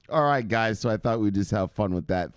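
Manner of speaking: usual speaking voice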